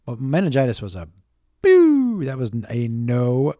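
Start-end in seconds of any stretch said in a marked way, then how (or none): 1.6-2.2 a high, loud boo; mock boo. Comic sums up meningitis, high mock boo. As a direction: boo it like a jeering crowd.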